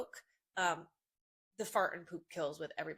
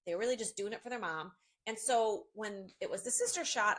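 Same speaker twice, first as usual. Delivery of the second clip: ordinary talk, nothing marked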